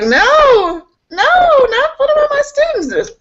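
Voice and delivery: mock wailing protest. Teacher told to leave her classroom, mock wailing. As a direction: wail it like a hammed-up plea.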